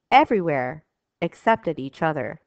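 usual speaking voice

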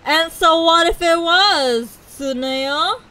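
loud, drawn-out challenge, pitched high; indignant retort. Pretend. Streamer talking back at game characters, indignant and loud. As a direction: demand it slowly and loudly.

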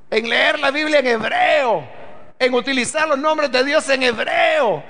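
slow, ringing pulpit proclamation, every phrase hammered home; preaching at full force. Acted. Preacher to his congregation about the new creation, full-force preaching.

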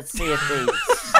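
excited laughing shout. Real, not acted.

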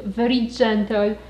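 normal talking voice

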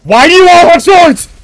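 high, loud startled yell; shouting. Real, not acted. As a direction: shout it out in alarm. Sudden outburst at enemies.

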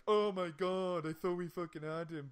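lightly voiced whiny Danny; mock mourning hero. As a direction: ham up the hero's despair. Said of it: "he's like".

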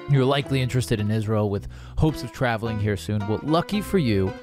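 ordinary delivery

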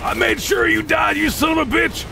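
high, furious yell; triumphant angry shout. Real, not acted. Cursing downed enemy.

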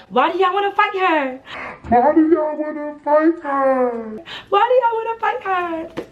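very high, stretched-out mimicry of a protest; mock the friend in a squeaky, dragged-out wail; mocking whiny imitation. Teller mimics rival's best friend defending her, mocking high whine.

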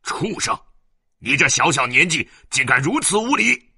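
high, loud enraged father; furious scolding. Acted. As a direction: roar at your unruly son. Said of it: "员外大怒，骂道".

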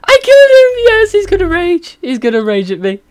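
very high, loud triumphant squeal, easing off at the end; gleeful shriek. Real, not acted. Gamer squealing to viewers after killing a rival. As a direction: screech with delight at the kill.

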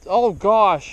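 sudden loud "oh gosh"; startled. Real, not acted. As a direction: blurt it out in alarm. Line snagged, sudden exclamation.

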